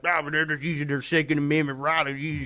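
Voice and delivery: garbled mocking imitation; mock gun defender. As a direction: babble out a caricatured excuse. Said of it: "they were like".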